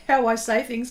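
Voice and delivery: ordinary delivery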